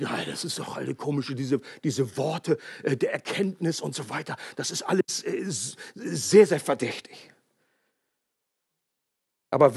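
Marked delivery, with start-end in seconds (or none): 0.0-7.3 leise, tiefe, misstrauische Nachahmung; misstrauisch nachgeahmt. Retold or imitated. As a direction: die Skeptiker abschätzig nachäffen. Skeptiker über Geistesgaben nachgeahmt. (Prediger ahmt misstrauische Wortgemeinde nach, gedämpft spöttisch)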